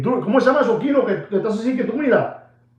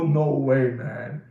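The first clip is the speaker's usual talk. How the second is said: stunned disbelief; 7 semitones lower, 5 dB quieter, slower than usual. Retold or imitated. Imagined viewer reaction.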